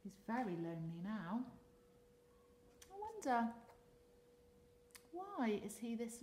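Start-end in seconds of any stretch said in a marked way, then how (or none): none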